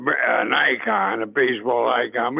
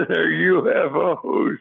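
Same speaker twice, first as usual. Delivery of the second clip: laughing punchline; 4 semitones higher, slower than usual. Retold or imitated. Player cracking up while telling the skipper his joke. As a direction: chuckle through "U F Os", spaced slowly.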